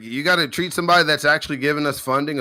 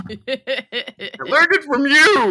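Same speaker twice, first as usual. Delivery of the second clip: high, loud, drawn-out comeback; loud teasing accusation; 11 semitones higher, 4 dB louder than usual. Real, not acted. To the co-host blamed for teaching narcissism, loud teasing accusation. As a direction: throw it straight back, high and stretched out. Same blame thrown directly at him.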